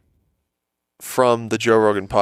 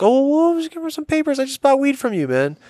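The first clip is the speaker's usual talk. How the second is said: much higher, squeaky mock-innocent voice; innocent excuse. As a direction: play the caught kid pleading innocence, way up high. Caught, excusing himself to employee.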